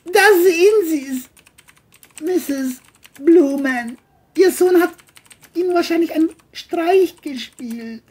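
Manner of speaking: high, loud put-on voice, smug; John smug. John tells the mother her son played a prank, high and smug. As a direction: brush her off smugly.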